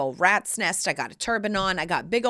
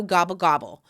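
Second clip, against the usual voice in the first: turkey gobble imitation; 2 semitones lower than usual.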